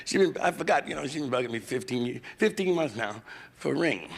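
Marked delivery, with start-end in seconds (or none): none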